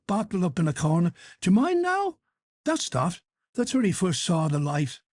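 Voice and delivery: high, lively scandal-telling; a touch of gossipy glee; gleeful gossip. Acted. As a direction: relish spilling the dirt. Henchy gleefully tells gossip.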